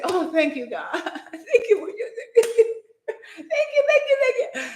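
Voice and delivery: laughing, rising high with joy; laughing thanks. Retold or imitated. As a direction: giggle the thank-yous, overjoyed. Thanking God after hearing voice.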